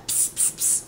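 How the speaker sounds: short hissed bursts; spray can noise. Retold or imitated. Imitating friend spraying the roach, soft hissed squirts. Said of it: "from him spraying or whatever".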